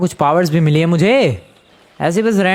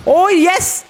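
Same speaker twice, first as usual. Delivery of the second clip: very high-pitched elated cry; high, thrilled exclamation; triumphant cheer; 14 semitones higher than usual. Real, not acted. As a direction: celebrate a sudden success. Hammer power works.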